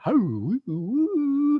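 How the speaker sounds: imitating a run of climbing whoops; high rising woo calls. Mimicking the singer's call on the ridge, high woos. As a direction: sing out high woo tones. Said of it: "There was all these beautiful tones".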